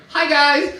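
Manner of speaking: high, loud mock vlogger greeting; annoying tutorial host. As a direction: chirp it like an irritating makeup YouTuber. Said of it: "they're so annoying".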